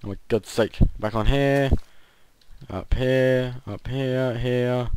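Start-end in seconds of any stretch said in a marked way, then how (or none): none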